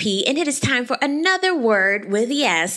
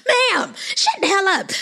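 exasperated scold; 10 semitones higher than usual. Pretend. Telling Robin off.

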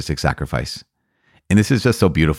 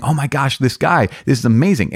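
mock excited gush; 6 semitones higher than usual. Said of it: "a lot of Catholics got super excited going".

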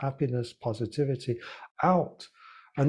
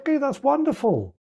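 bright cheer; 11 semitones higher, 4 dB louder than usual. Pretend. Cheerful reframing of mistake.

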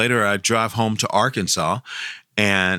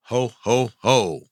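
measured Santa belly-laugh call; spaced-out jolly Santa catchphrase; Santa Claus ho-ho-ho; slower than usual. Shown as a demonstration. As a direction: give a Santa's ho, ho, ho. Gives Santa's catchphrase when asked.

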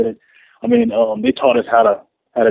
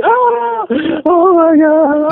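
high, slow mock wail of pain; imitated screaming; 8 semitones higher, much slower than usual. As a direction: act out the woman's anguished screaming, drawn out. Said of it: "She's like".

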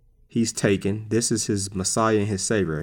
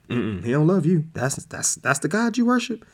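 scoffing, swooping up higher; mock disbelieving devil; 6 semitones higher than usual. Devil mocking the believer's God, scoffing disbelief. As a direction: needle them, pitch climbing into the question.

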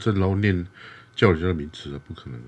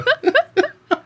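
a very high short laugh; squeaky high laugh; 3 dB louder than usual. Real, not acted. Laughs after remark. (尖细的笑声)

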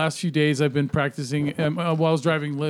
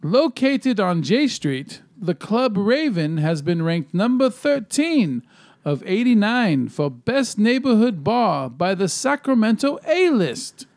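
announcer guy; 5 semitones higher than usual. Acted. Host reads a bar ranking from the list, announcer guy voice. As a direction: sweep up and down like a hammy announcer. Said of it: "it's like my old announcer guy voice".

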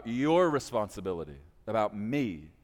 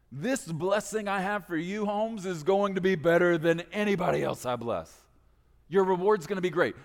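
higher, louder casual voice of God; a lightly voiced God, chummy and generous; God bragging to his buddy about the reward; playful God; 5 semitones higher, 4 dB louder than usual. Pastor voices God in a casual paraphrase.

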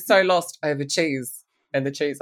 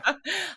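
light giggle; a short tickled laugh; high delighted laugh; 10 semitones higher, 7 dB quieter than usual. Real, not acted. Host laughs after the story.

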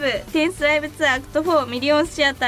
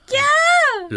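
excited squeal; 13 semitones higher, 6 dB louder, much slower than usual. Real, not acted. Girly shriek at "lover.

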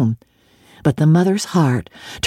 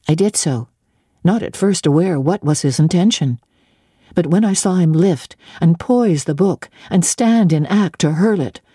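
usual speaking voice

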